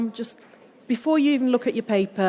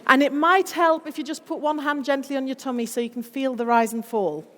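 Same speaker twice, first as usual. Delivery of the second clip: ordinary delivery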